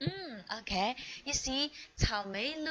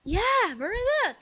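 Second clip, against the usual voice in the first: much higher, delighted very good; excited praise; 13 semitones higher, 4 dB louder than usual. Real, not acted. Teacher praising child's answer.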